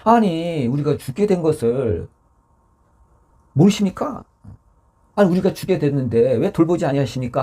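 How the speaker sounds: mildly hammed-up cry of complaint; mock desperate yell. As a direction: act out disciples pleading in alarm. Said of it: "소리를 막 지른 거죠".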